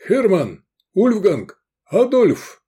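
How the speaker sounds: high, loud shouts of names; booming roar. A narrator's reading. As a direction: bellow each name to summon the men. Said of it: "заревел чей-то зычный голос". (зычно выкрикивая имена)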